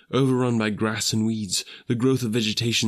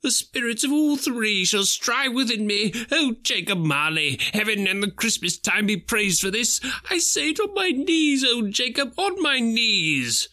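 higher, fervent thankful praise; Scrooge, rejoicing; 14 semitones higher than usual. Acted. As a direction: give thanks from his knees.

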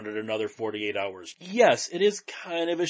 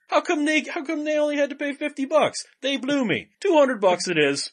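much higher-pitched character voice; quoted movie character; 12 semitones higher, 4 dB louder than usual. Acted. Quoting a favorite comedy to a co-host, high character voice. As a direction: act out the film line, pitched way up.

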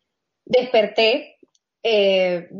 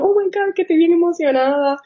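excited; 8 semitones higher than usual. Retold or imitated. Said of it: "Y yo".

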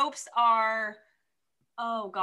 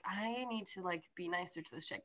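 much softer, murmured to himself; quiet inner thought; 5 semitones lower, 13 dB quieter than usual. Retold or imitated. The hero privately resolving to be nicer to her, very soft. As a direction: voice his private thought under the breath.

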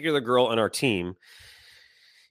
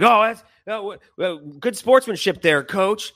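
high, raised sarcastic jeer; mock sarcastic; 7 semitones higher, 4 dB louder than usual. Rival coach needles wife after refused handshake, mock sarcasm. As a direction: imitate a snide rival coach. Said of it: "Are you being sarcastic to my wife".

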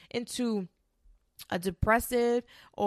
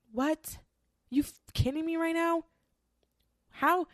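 high-pitched, stunned disbelief; high, incredulous what-are-you-kidding-me; 9 semitones higher than usual. Real, not acted. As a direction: shoot up in pitch, unable to believe it. Disbelief at women who enjoy failure.

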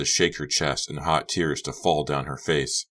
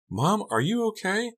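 worried. A narrator's reading. Daughter worried about mother.

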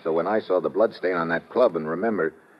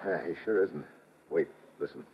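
hushed; 6 dB quieter than usual. Acted. Steve tells Lord Byron to follow quietly, hushed. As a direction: keep it down so nobody hears. Sneaking after a suspect.

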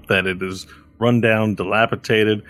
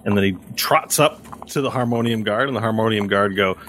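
ordinary delivery

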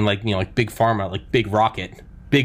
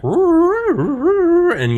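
sci-fi sound effect; 5 dB louder than usual. Retold or imitated. Imitating fifties sound effects.

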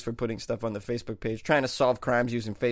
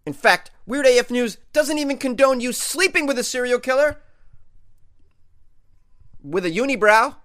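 excited; 10 semitones higher, 6 dB louder than usual. Real, not acted. Comic disclaimer escalating.

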